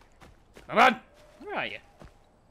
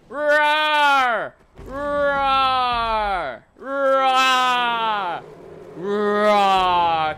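long, drawn-out play roars; angry lion roar; 4 dB louder, much slower than usual. Pretend. Player being an angry lion, long mock roars. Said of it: "I'm an angry lion".

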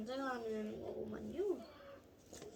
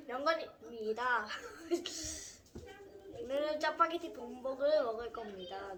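ordinary talk

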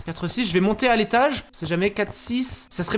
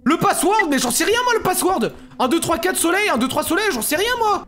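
high, loud, exasperated babble; exasperated protest; 8 semitones higher, 3 dB louder than usual. Real, not acted. Gamer asked for a password he lacks, exasperated protest. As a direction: protest at a high pitch that you have no idea.

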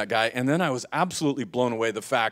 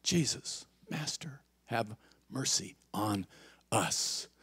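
very soft, near-whispered plea; hushed prayer; 3 semitones lower, 13 dB quieter, slower than usual. Shown as a demonstration. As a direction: breathe each word of the prayer slowly. Quiet repeat of the six-word prayer.

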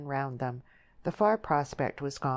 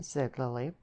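plain reading voice

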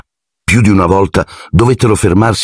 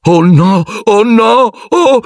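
high, rising frightened wails climbing higher each time; panicked cries; much slower than usual. A narrator's reading. Ron panicking at the beast in the dark, high frightened wails. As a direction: Ron losing his nerve at the noise.

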